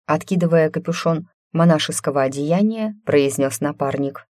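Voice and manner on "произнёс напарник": usual speaking voice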